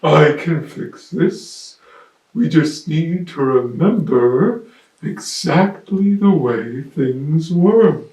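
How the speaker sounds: big ponderous elephant, projected; trumpeting elephant. Acted. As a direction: announce it loudly as a big elephant, stretching the words. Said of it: "The elephant knew just what to do".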